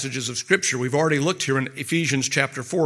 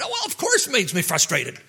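higher, fed-up "of course"; patient irritated by the therapist's echo, higher and exasperated; exasperated client; 9 semitones higher than usual. Pretend. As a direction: roll your eyes at the obvious question.

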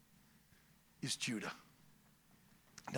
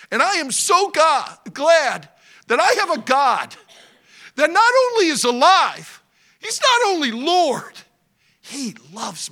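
full-force preaching; 10 semitones higher, 10 dB louder than usual. Acted. Preacher proclaiming God's lordship loudly. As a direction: preach it out to the congregation.

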